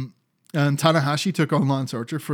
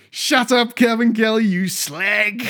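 mock insult; 8 semitones higher, 4 dB louder, slower than usual. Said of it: "he just goes".

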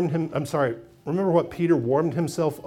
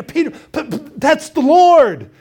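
high, stammering and slowed, with a loud finish; excited realization; 10 semitones higher, 8 dB louder than usual. Acted. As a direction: blurt it out in awe. John recognizes Jesus.

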